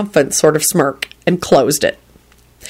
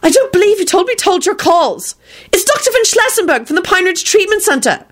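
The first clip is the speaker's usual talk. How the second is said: very high, indignant secretary; near shriek; 12 semitones higher than usual. A narrator's reading. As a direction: scold over the intercom, pitched way up. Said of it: "Beatrice nearly shrieked".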